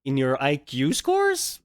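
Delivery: usual speaking voice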